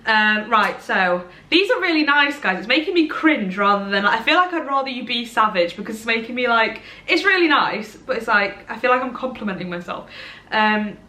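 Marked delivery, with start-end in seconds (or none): none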